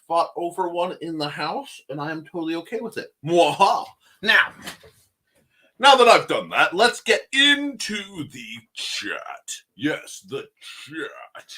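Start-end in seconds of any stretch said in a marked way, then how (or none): 3.2-3.9 short hammy villain laugh; a quick mwaha like a cartoon villain; evil cackle. Mock evil laugh after shirt reveal. 4.2-11.5 slow, drawn-out villain voice; lightly hammy cartoon villain; mustache twirling villain. Villain voice continues after cackle.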